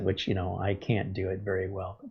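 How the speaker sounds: normal talking voice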